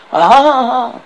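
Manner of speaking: loud, high taunting "ha ha"; mock laughter. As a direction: laugh scornfully at the knights. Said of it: "French guards laughing".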